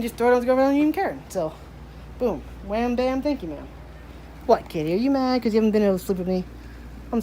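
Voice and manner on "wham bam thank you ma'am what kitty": ordinary talk, nothing marked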